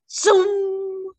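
high excited zoom of a racing car; zoom sound effect. A narrator's reading. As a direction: shoot the word up like a car taking off. Car speeds off in story.

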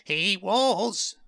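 high, boastful seed voice; puffed-up little character, high-pitched; proud little seed. A narrator's reading. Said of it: "said Bert proudly".

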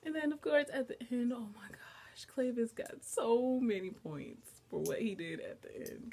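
high, loud fangirl gush with a hushed gasp; real gushing excitement. Real, not acted. Fan praising Clavis's move at the end, gushing. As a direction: burst out in delighted awe. Gushing over favorite moment.

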